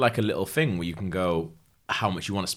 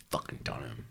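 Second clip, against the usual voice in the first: hushed gloating; 11 semitones lower, 14 dB quieter than usual. Real, not acted. Gloating aside about the money.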